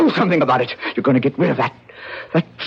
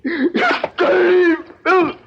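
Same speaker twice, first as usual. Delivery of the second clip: high shout, half laughing, half crying; frenzied shout; 10 semitones higher than usual. Acted. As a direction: madman screaming at his mother's statue. Attacking mother's wax figure.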